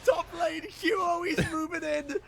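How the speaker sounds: esports caster. Real, not acted. Casts through laughter.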